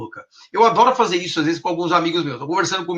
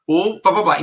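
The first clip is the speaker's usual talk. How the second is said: ordinary talk, nothing marked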